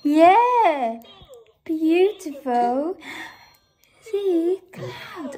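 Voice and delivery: high, drawn-out swooping words; big singsong encouragement, stretched slowly. Real, not acted. Showing things to her young daughter.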